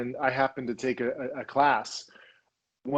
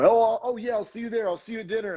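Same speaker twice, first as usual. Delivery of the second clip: higher, gushing fake promise of an LA flake; mock LA flake; 8 semitones higher, faster than usual. [name] mocking LA people who promise then flake, higher gushing mock voice. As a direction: send up someone who'll never show. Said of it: "People say they're gonna do one thing and they do the other".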